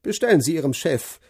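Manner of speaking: curt, grumbling farmer about the bill; gruff farmer. Acted. Narrator voices farmer Brown. (knurriger Bauer, der sich über die Rechnung beschwert)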